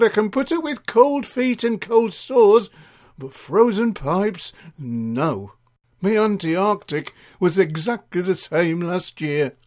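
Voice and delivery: gossiping Mavis, complaining. Acted. Acts Mavis telling her woes.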